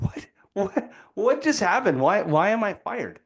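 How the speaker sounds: stunned, asking why you were fired; shocked disbelief. Retold or imitated. Young employee to investor, baffled and rising.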